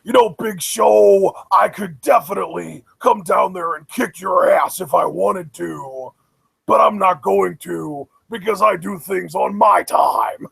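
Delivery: growly, angry monster voice, imitated; deliberate wrestler threat in a mock monster growl; Braun Strowman. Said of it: "this growly, angry monster voice".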